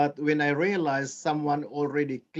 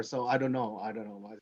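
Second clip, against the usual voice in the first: normal talking voice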